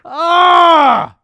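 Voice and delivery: loud high yelp of disbelief; disbelieving shout. Real, not acted. Laughing disbelief at replies.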